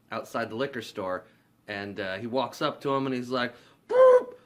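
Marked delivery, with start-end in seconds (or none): 3.9-4.3 sudden high, loud whistle hoot; whistle blast imitation. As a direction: mimic a guard's whistle with your voice. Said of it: "He blows the whistle again".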